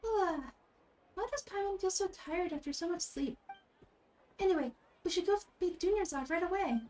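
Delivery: high, bright companion voice; chirpy little sidekick; Paimon voice. Acted.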